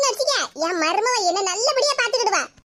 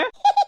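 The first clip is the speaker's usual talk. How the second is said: high, bright burst of laughter; delighted laugh; 10 semitones higher than usual. Acted. Mother-in-law laughs gleefully at her own surprise.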